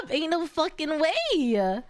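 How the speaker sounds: ordinary delivery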